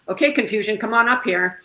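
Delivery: calling out. Retold or imitated. Calling out to her confusion.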